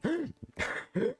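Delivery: high, sharp gasp for air. Acted. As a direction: suck in air after sprinting. Soldier arriving out of breath.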